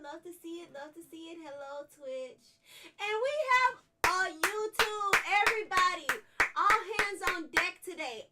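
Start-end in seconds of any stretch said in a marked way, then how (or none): 3.0-8.3 loud, high rallying call; hype shout. Real, not acted. Streamer rallying her chat across platforms, shouting. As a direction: pump up the whole crowd. Hyping all four platforms.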